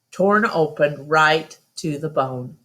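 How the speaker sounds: ordinary delivery, nothing marked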